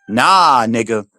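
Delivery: ordinary talk, nothing marked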